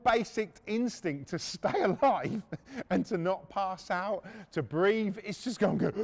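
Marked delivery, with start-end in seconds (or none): none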